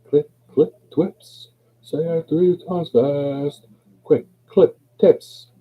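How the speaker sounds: high, drawn-out sing-song; high deliberate recital. Shown as a demonstration. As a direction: pitch it up and space out each word. Tongue twister, higher take.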